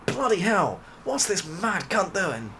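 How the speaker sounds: high-pitched mock disbelief, lightly acted; baffled player. Seasoned player watching the streamer's odd setup, mock bafflement. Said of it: "you're looking at this and going".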